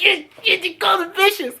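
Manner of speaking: high-pitched giddy reenactment; excited, stammering high squeal. Retold or imitated. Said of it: "I was so giddy".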